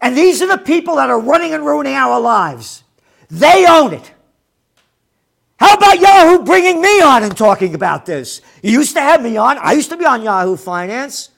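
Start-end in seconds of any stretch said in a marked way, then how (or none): 3.3-8.4 much higher and louder, heated; angry rant. Real, not acted. Railing at the media, he rants in loud anger. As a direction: let the outrage spill over.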